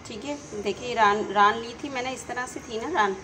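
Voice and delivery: ordinary talk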